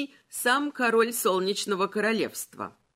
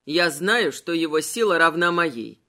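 lower, louder haughty king; skeleton voice; 5 semitones lower, 4 dB louder than usual. Acted. Malachi voiced. (низкий властный голос короля)

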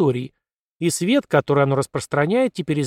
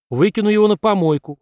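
хрюкнул; 6 semitones higher, 3 dB louder than usual. A narrator's reading. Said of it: "хрюкнул Джонни".

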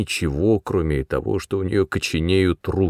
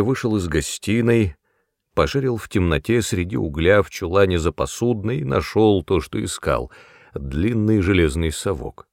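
usual speaking voice

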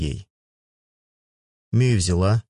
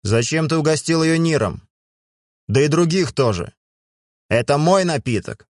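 angry; 7 semitones higher than usual. Acted. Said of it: "бросил на меня злой взгляд".

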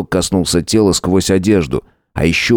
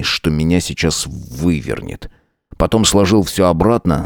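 usual speaking voice

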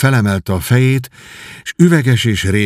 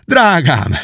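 raised, impassioned cry; 4 semitones higher than usual. A narrator's reading. Miles leaps toward Edith, crying out to her. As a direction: call out to a beloved, high and ardent. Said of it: "és így kiáltott fel".

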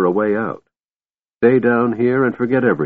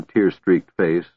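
plain reading voice

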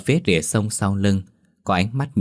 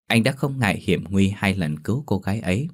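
ordinary delivery

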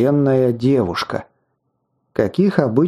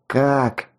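ordinary delivery

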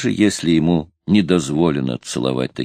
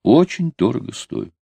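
higher, insistent second "очень"; stubborn; 4 semitones higher than usual. A narrator's reading. Girl insisting on her price to the sailor, stubborn. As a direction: dig in and insist. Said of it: "упрямо повторила девушка".

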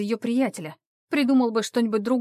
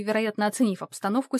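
usual speaking voice

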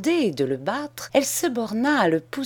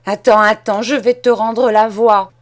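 very loud, raised threat; furious threat; 3 semitones higher, 9 dB louder than usual. Acted. Cousin grabs her switch to beat Charles, very loud. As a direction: menace him with the switch.

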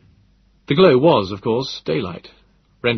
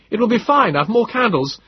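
higher, louder reassuring call; reassuring Moses; 12 semitones higher, 5 dB louder than usual. A narrator's reading. Moses reassuring them while crossing the dark cabin, raised. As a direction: call back calmly but raised.

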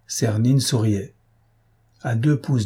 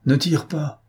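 ordinary delivery

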